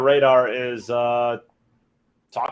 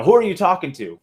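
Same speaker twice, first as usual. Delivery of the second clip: raised, higher voice from the background; calling out; 6 semitones higher, 5 dB louder than usual. Acted. Dog interrupting a phone call in a joke, calling out. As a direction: call over to someone on the phone.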